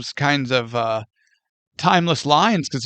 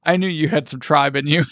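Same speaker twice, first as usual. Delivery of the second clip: ordinary talk, nothing marked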